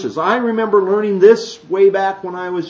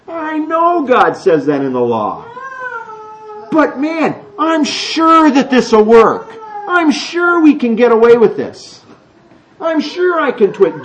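louder, higher smug self-assurance, drawn out on "I'm sure"; cocky fool; 9 semitones higher, 3 dB louder, slower than usual. Retold or imitated. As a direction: play the know-it-all who is sure he'll get away with it. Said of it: "You look at it and go".

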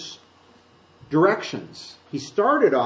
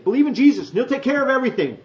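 mock sales pitch; 9 semitones higher, 4 dB louder, faster than usual. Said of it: "You never see Peter and Paul saying".

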